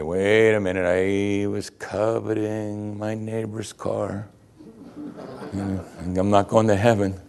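slow, drawn-out monotone worry; mock uneasy listener. Preacher voices a listener worried about coveting, mock uneasy. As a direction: stretch it out as a mock-worried churchgoer. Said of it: "does that make you uneasy".

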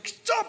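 very high, cut-off cry; panicked cry. Acted. Jezebel as the servants grab her, high panicked cry. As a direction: cry out as you are grabbed.

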